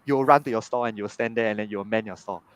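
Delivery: ordinary talk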